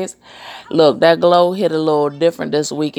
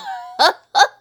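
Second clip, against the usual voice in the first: high laugh; 12 semitones higher than usual. Real, not acted. Laughing at own joke.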